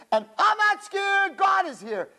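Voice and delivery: high, loud, slow mock defiance; mock bravado. Mocking a girl in the bomb shelter, loud bravado. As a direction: ham up the fearless boast.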